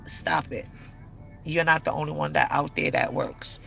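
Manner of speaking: usual speaking voice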